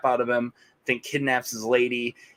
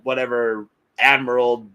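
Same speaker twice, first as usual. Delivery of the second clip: normal talking voice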